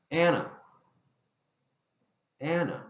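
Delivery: Anna with stress on the first syllable; ANna, stressed then weak. Shown as a demonstration. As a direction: hit the AN of Anna. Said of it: "that stress pattern".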